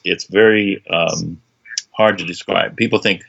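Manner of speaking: ordinary talk, nothing marked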